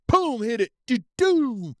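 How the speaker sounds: high-pitched boom and tumble noises; crash sound imitation. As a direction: mimic the truck smacking the berm. Voices the truck's crash.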